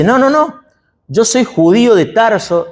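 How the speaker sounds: indignant defence. Acted. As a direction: push back hard, insulted at the mix-up. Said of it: "Pablo se defiende y le dice".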